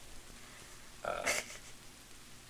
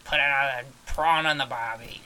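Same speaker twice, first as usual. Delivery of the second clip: loud, hammed-up Australian; Australian accent; 8 dB louder than usual. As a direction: belt out a cliché Australian accent. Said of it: "I can do an Australian accent".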